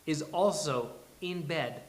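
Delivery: loud, high, punchy billing; big projected narrator delivery; announcer-style introduction. A narrator's reading. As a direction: hype the character like an announcer. Narrator introduces Grace with gusto.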